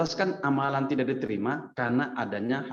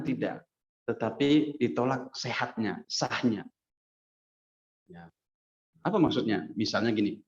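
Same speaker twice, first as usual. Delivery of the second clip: ordinary talk, nothing marked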